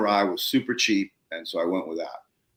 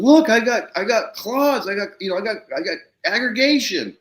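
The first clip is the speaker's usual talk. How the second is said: high, breathless-to-show-you imitation; eager, stumbling brag, pitched up; retold excited boasting; 11 semitones higher, 5 dB louder than usual. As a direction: act out a farmer showing off his find. Farmer proudly showing soil to neighbor.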